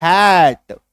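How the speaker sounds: hat with a long "ae" vowel; open vowel demo. Shown as a demonstration. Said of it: "double एकार की मात्रा".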